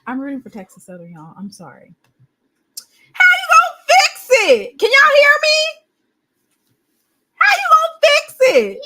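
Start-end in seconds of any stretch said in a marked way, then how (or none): 3.1-4.8 high, loud hammed-up shout; mock yell. Streamer hollering a mock challenge to chat, hammed-up shout. 4.8-5.6 high, loud call to chat; loud mic check. Real, not acted. Streamer checking his audio with chat, loud high call. 7.3-8.8 high, loud hammed-up shout; mock yell. Streamer hollering a mock challenge to chat, hammed-up shout.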